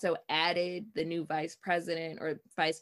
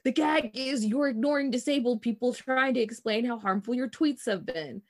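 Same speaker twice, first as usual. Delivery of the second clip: raised, emphatic tweet reading; all caps; 6 semitones higher, 5 dB louder than usual. Acted. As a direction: read it out as if typed in capitals. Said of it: "in all caps".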